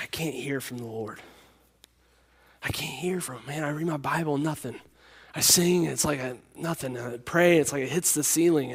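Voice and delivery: put-on hushed, broken whisper of a crying boy; retold tearful whisper. Young man at camp confessing to the pastor, tearful whisper. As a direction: whisper it like a kid choking back tears. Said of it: "he's, like, crying".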